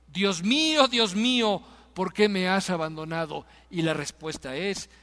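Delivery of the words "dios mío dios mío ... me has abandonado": loud, raised retold cry; Jesús. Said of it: "lo que está gritando". (grito citado, fuerte y elevado)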